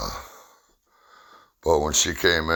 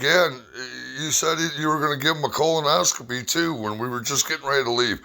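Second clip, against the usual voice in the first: wife's voice; 10 semitones higher, faster than usual. Retold or imitated. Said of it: "she's like".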